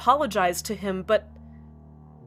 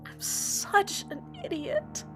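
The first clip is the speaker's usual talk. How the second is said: self-reproach; 4 dB quieter, slower than usual. Acted. Self-reproach after sigh.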